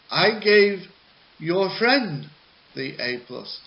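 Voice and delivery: I gave said high and loud; teacher leaning on gave and friend; stressed, indignant teacher reply; teacher stressing. Pretend. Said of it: "play both roles of the teacher".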